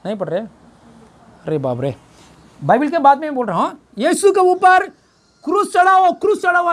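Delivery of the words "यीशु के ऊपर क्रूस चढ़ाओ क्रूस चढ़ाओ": raised high, crying out for the cross; preacher voicing the angry mob, pitched high; mock crowd shout. Preacher acts the crowd demanding crucifixion, high raised cry. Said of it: "ऐसा बोल दिया ये लोग ने".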